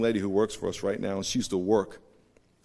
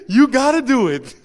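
loud, high-pitched comic urging; hammed-up pleading push; mock insistent cry; 13 semitones higher, 12 dB louder than usual. Mock-tearful urging of scared girl.

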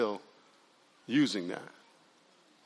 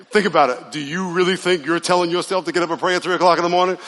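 ordinary delivery

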